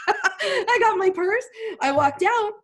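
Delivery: real laugh while she tells it. Real, not acted. Recalling grabbing her purse, laughing as she talks. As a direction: laugh through it, slow and high.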